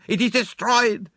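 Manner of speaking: DESTROYED cried high and loud, falling; anguished statement. A narrator's reading. Poirot laments lost evidence, high despairing statement. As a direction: wail it slowly as a final verdict. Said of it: "his anger burst forth freely".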